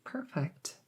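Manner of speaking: soft whispered praise; whispered reassurance to the patient. Acted. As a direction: breathe the word out approvingly. Whispered approval.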